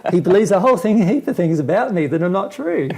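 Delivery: laughing exasperation; amused disbelief. Pretend. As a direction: marvel at him, half laughing. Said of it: "You'll look at me and go".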